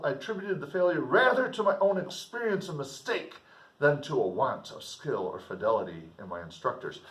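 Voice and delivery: measured literary reading; steady classroom reading of a novel; novel read aloud. Acted.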